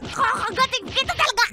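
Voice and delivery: loud attacking shout; angry threat. Acted. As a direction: threaten him at full voice. Girlfriend attacks boyfriend. (galit na pasigaw)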